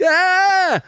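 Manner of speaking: high-pitched, loud "aah"; mock alarmed scream. Him reacting to the imagined role swap, mock scream. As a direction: let out a hammed-up high yell.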